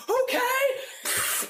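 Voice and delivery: high, startled okay; a startled squeak of okay; high surprised okay. Acted. Narrator voices surprised driver.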